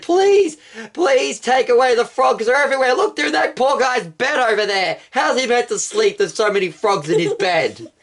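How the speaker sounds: high, begging whine; pleading Pharaoh. Acted. Pharaoh begging Moses to take away the frogs, high whiny plea. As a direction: beg for the frogs to go. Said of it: "he pleaded".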